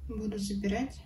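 usual speaking voice

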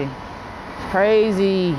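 CRAZY stretched out very slowly, pitched high; drawn-out emphasis. Real, not acted. Vlogger telling viewers how wild this feels, drawn-out emphasis. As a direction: linger long on the word "crazy".

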